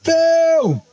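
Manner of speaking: high call across the room; bright hail to a friend. Real, not acted. Calling out to a viewer.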